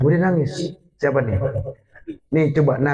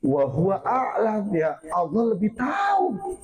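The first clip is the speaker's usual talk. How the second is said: Prophet's answer; 4 semitones higher than usual. Retold or imitated. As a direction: stretch it upward into a high cry. Voices the Prophet answering Jibril.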